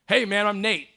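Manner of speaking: loud, high, upbeat greeting; Nate's cheerful hello. Retold or imitated. Nate introducing himself in the gym, loud and high. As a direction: greet him like a cheerful stranger walking in. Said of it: "he's like".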